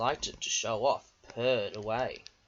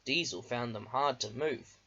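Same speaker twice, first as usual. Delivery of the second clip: ordinary delivery, nothing marked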